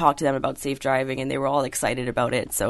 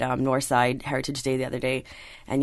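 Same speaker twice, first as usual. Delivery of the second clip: ordinary delivery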